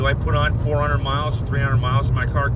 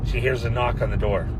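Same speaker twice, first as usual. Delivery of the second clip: usual speaking voice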